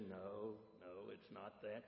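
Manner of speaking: low, very soft, weary-wise reply; quiet old dog's voice; old greyhound. Acted. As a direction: gently dismiss it, low and hushed. Old greyhound answering in story.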